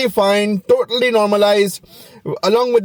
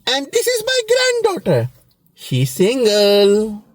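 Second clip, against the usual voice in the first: little grandma; 2 semitones higher, slower than usual. Retold or imitated. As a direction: play a matchmaking grandma, dragging out the hint. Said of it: "that little grandma will go like".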